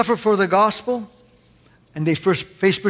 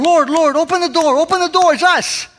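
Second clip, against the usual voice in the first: higher, louder, knocking and begging; desperate pleading; 9 semitones higher, 4 dB louder than usual. Retold or imitated. Foolish virgins begging the Lord at the shut door, higher louder desperate plea. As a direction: beg to be let in, building. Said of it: "they come".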